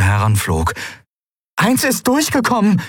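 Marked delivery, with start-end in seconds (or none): 1.6-2.8 high, urgent cry; sudden high-pitched exclamation; calling out. A narrator's reading. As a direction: call out the alarm, pitched high. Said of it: "rief sie".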